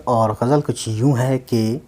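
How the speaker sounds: ordinary talk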